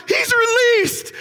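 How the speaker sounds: high, slow, overjoyed announcing; thrilled declaration; excited. Acted. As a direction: she bursts with the good news. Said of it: "Rhoda's like".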